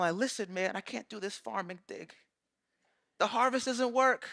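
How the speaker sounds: usual speaking voice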